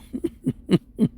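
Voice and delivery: soft, stuttering chuckle; amused laugh. Real, not acted. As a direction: chuckle quietly at your own joke. Laughs at own hairline joke.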